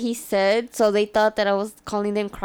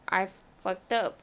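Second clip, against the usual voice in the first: dazed regret; 3 semitones lower, 8 dB quieter than usual. Retold or imitated. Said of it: "I was like".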